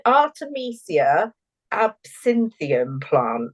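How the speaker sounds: Artemisia absinthium said slowly and carefully, syllable by syllable; Harry Potter spell. Real, not acted. Co-host hears it as casting a Harry Potter spell, slow and deliberate. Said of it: "you sound like you're trying to do a Harry Potter spell".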